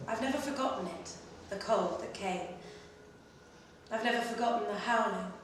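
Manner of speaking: usual speaking voice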